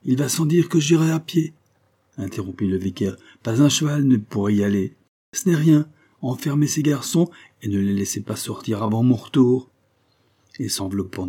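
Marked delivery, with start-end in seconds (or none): 0.0-1.5 higher and louder, cutting her off; a stern vicar overriding objections; firm insistence. Acted. Vicar interrupting Kezia, insisting he will walk, firm and raised. 3.4-9.7 higher and louder commands; a master brooking no argument; stern orders. Acted. Vicar ordering Kezia to lock up the boys, stern and louder.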